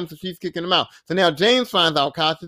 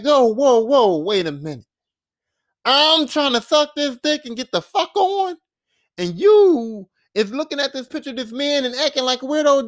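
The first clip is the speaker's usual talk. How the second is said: higher-pitched, mock indignant protest; indignant objection; 6 semitones higher, 3 dB louder than usual. Alyssa confronting James over the picture, indignant retelling. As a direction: act out her stopping him in disbelief. Catches him looking at man's picture.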